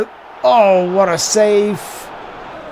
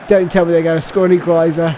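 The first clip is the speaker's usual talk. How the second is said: ordinary talk, nothing marked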